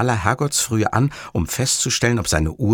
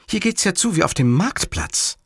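high-voiced complaint; mildly cross; 9 semitones higher than usual. A narrator's reading. Livia complains behind him, mildly cross and high. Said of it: "ihre Augen glitzerten vor Wut".

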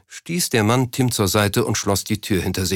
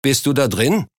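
higher-pitched called question; moderately raised questioning call; 4 semitones higher than usual. A narrator's reading. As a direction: call through to the next room, voice lifted. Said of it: "rief er".

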